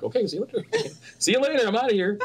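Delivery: high, laughing carefree goodbye; laughing mock farewell. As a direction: toss it off laughing, happy to leave. Retold cheerful quitting.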